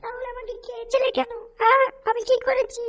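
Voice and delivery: very high, soft chirpy reproach; a small bird asking why it was eaten; little bird voice. Acted. Bird's voice accusing the cat of eating it, high and plaintive.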